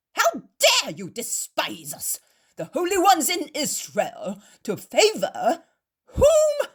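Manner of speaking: loud, high furious accusations; shouting. Acted. Proud Pharisees accuse the host, furious shouting. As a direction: hurl it at him like an outraged zealot.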